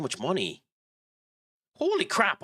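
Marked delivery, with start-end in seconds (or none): 1.8-2.4 high, sudden shocked outburst; shocked exclamation. Real, not acted. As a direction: blurt it out in shock. Shocked at player's wage.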